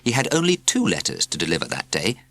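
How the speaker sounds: plain reading voice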